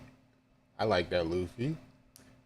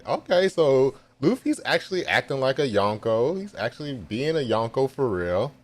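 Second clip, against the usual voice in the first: higher and louder, thrilled; excited fan reaction, raised voice; hyped; 7 semitones higher, 5 dB louder than usual. Real, not acted. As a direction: gush like a proud fan.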